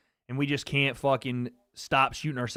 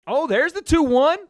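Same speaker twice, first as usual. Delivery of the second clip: much higher and louder, real excitement; excited shout; 11 semitones higher, 10 dB louder than usual. Real, not acted. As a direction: yell it as the punch lands. Cheering on his fighter live.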